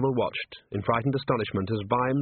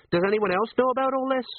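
put-on higher, nosy old sergeant; pry gently, a touch higher; lightly voiced curious sergeant; 12 semitones higher than usual. Colon quizzing Nobby about his news, higher.

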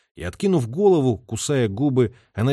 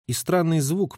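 plain reading voice

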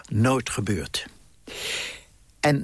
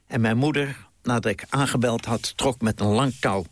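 normal talking voice

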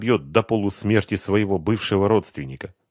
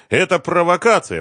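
high, loud anxious voice; agitated and pitched way up; panicky protest; 12 semitones higher, 7 dB louder than usual. A narrator's reading. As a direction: protest shrilly in fear. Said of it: "пробормотал Семён".

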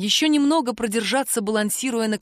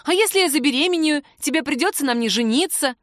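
high, worked-up fretting; wailing; 6 semitones higher, 3 dB louder than usual. A narrator's reading. Woman warns lover he must marry, lamenting. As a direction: lament with mounting fuss, pitched up. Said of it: "запричитала Кира".

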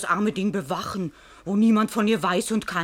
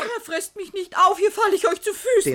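higher, frantic begging; pleading in fear; 12 semitones higher than usual. Acted. Maid begs her raging master for mercy, terrified. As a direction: throw yourself at his feet.

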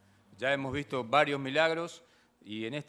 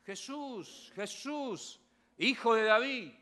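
blind men crying out; 9 semitones higher, much slower than usual. Retold or imitated. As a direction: call out to Jesus, begging. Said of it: "a gritar".

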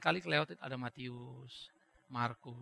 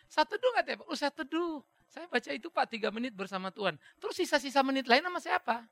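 smug churchgoer; 7 semitones higher, 4 dB louder than usual. Retold or imitated. Churchgoer boasting of three-minute devotion.

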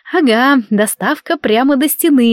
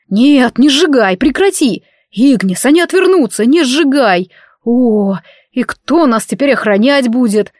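pleading shout; 3 semitones higher, 3 dB louder than usual. Acted. Husband begs her not to burn.

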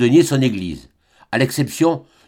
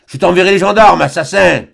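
loud, higher retreating threat; shouted threat; 5 semitones higher, 6 dB louder than usual. A narrator's reading. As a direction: yell it while backing off. Le frère recule et menace. (crié en reculant)

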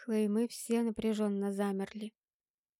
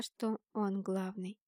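ordinary delivery

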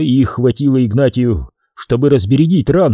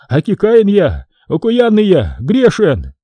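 high wail, dropping at the end; high-pitched penitent lament; lamenting confession; 8 semitones higher than usual. A narrator's reading. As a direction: wail in repentance. Priest laments his sins aloud.

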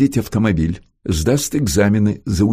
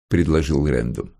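ordinary delivery, nothing marked

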